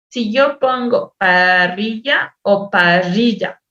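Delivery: slow, "parrilla" said with a single tap and then with a long trilled rr; exaggerated rolled R. Shown as a demonstration. Teacher contrasts tap and trill in "parrilla" for a learner, slow and exaggerated. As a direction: stretch the rr in "parrilla" to show the difference. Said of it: "hasta una exageración en el sonido".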